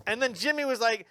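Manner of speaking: ordinary delivery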